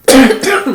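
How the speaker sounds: short loud cough. Real, not acted. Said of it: "excuse me".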